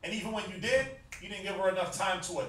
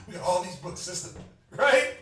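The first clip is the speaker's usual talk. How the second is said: playful mock flirting, half laughing; mock thirsty man; 5 dB louder than usual. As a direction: ham up a thirsty brother showing off his books to a sister. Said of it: "Trying to sound thirsty".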